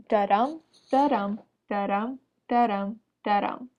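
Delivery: da-dum with the stress on dum; iambic beat. Shown as a demonstration. Student demonstrating the iambic beat da-dum to the class. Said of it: "This sounds like".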